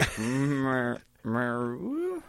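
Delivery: high, rising owl hoot; owl hoot imitation. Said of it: "she's like".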